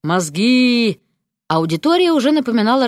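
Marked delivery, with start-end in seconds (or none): 0.0-1.0 drawn-out zombie groan; zombie moan. A narrator's reading. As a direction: moan it like the hungry undead. Zombie moans for brains again.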